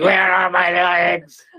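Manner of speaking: high, slow groaning zombie. Acted. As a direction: moan it like a lost zombie. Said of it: "That was a really great zombie voice".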